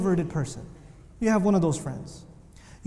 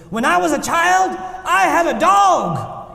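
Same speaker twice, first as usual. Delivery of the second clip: high, loud, booming sermon shout; fiery preacher; 10 semitones higher, 8 dB louder, slower than usual. Retold or imitated. Imitating Imam Siraj's story, shouting high and loud. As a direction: preach it like a roaring orator. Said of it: "He goes".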